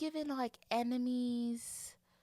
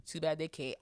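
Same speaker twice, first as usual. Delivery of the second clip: usual speaking voice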